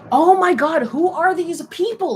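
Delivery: loud, high-pitched mock outrage; so mad. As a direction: imitate a furious man exploding at commenters. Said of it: "so mad".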